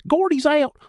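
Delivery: high gleeful taunt. Acted. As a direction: crow it out, high and loud. Card game taunt.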